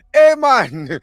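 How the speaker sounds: loud, high, mock Jamaican greeting; fake Jamaican accent. Tourist-shop hawker greeting customers, fake Jamaican accent. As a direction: call out in a bad Jamaican accent. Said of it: "I make a fake Jamaican accent".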